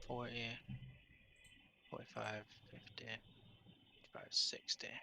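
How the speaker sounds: whispered, slow quiet counting; counting under breath. Real, not acted. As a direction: count the feet half-aloud to yourself. GM counting movement softly.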